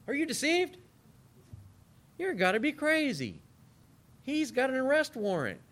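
high, indignant mock rebuke; mock Pharisee scolding. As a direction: scoff at the guards as a Pharisee.

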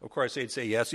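normal talking voice

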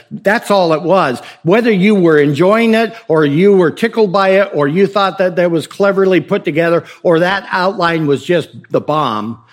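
normal talking voice

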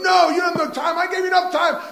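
high, loud refusal; furious shout. Retold or imitated. The servant yelling at the begging debtor, loud and high. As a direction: shout him down, no mercy at all.

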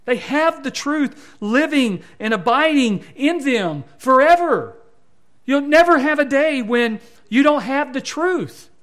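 high, loud pulpit proclamation; emphatic preaching. Real, not acted. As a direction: drive each statement home to the congregation. Preaches at full force.

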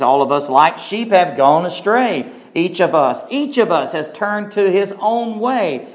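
high, drawn-out preacher's cadence; impassioned scripture preaching. Acted. Preacher proclaims Isaiah to the congregation, impassioned preaching. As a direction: proclaim the verse, stretching the key words in swooping arcs.

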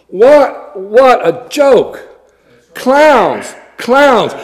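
usual speaking voice

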